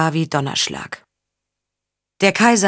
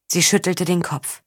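ordinary delivery

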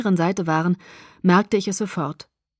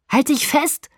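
higher-pitched warning call; 7 semitones higher than usual. A narrator's reading. Jacob to passenger as the motorbike races, calling out. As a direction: call it out over a speeding motorbike. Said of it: "rief Jacob".

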